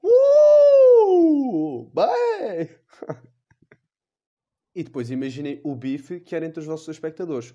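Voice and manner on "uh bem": high loud yelped cry; mock climax yelp. Guardian angel watching, yelping at the climax. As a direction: let out a hammed-up climactic yelp.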